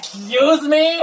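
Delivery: high, slow, affronted "excuse me"; offended. Retold or imitated. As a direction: play a prissy offended viewer. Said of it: "got highly offended and was like".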